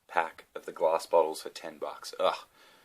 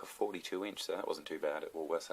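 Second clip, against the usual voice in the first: usual speaking voice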